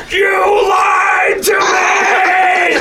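stretched, screaming outrage; Chris Farley freak-out. Retold or imitated. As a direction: bellow it like a betrayed customer losing it. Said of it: "And he starts freaking out going".

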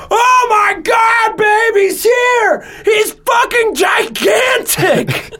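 slow, very high, loud astonished shouting; amazed shout. Retold or imitated. New dad seeing his huge newborn, slow amazed shout. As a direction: yell it out in disbelief, drawing every word out. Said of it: "on the video you hear me go".

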